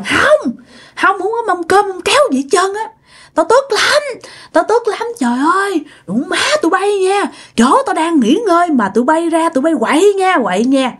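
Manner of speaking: high, wailing angry ghost; Trần Văn Nam. Retold or imitated. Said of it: "ổng đang hả là gào thét vậy đó". (giọng vong gào thét giận dữ)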